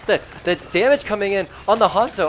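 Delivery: loud, high hype call; excited. Real, not acted. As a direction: get the crowd going. Caster hyped on damage.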